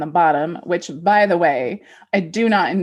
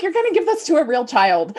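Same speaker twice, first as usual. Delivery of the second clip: mock alarm; 8 semitones higher, faster than usual. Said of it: "I'm like".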